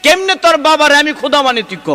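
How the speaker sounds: high-pitched, impassioned sermon delivery; high, projected waz storytelling; full-force preaching. Acted. Preacher acts out a heated exchange.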